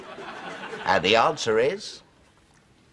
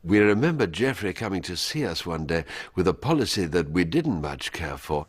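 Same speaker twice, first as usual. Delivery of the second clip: lightly voiced Thatcher, lower; regal, dismissive Thatcher; 5 semitones lower than usual. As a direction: Prime Minister refusing her minister, lower. Thatcher royal we.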